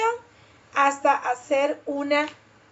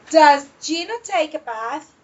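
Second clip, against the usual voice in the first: "Does Gino take a bath?" with the voice climbing on "bath"; rising question intonation; 4 dB louder than usual. Shown as a demonstration. Teacher showing the class question intonation, voice going up. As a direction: lift the pitch at the end of "bath". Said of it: "The voice goes up".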